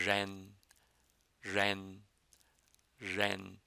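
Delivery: ren said properly with the tongue curled back. Shown as a demonstration. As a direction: curl the tongue again for ren. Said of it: "with the tongue curled again appropriately".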